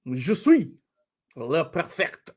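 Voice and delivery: mock French accent; playful French imitation. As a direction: show off in badly faked French. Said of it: "you're living in France".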